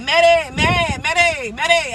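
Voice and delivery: put-on a drunk man calling after her, slurred; drunk stepdad calling. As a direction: call out the name like a staggering drunk. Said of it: "And he was calling me".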